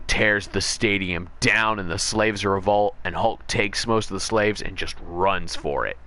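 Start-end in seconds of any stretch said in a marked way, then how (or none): none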